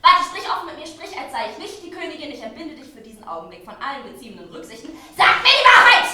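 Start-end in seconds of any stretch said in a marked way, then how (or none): 5.2-6.1 much higher and much louder demand; shouted demand. Acted. Queen demands the truth from her lady, in a loud high shout. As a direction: bark the order at her.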